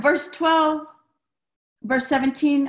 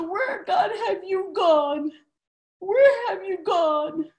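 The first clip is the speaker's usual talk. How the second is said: high, drawn-out cry to God; pleading lament; 5 semitones higher, slower than usual. Acted. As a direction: call out searchingly, stretching each word. Calling out to an absent God.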